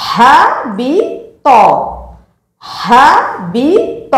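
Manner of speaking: হাবিত drawn out very slowly, each sound held; slow demo. Shown as a demonstration. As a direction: model হাবিত syllable by syllable. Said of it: "যেমন".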